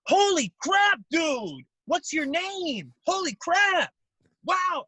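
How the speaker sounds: high shouted fanboy screams of Holy crap; over-the-top excited fan. Retold or imitated. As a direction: play a fan losing it on meeting a YouTuber, yelling high and loud. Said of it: "Why is he so excited".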